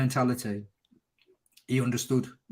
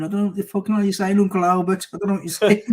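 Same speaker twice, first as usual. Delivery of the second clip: put-on higher, quick drunk taunting; mock taunt; 7 semitones higher, 4 dB louder than usual. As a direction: rattle it off high to mock him. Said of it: "I was going".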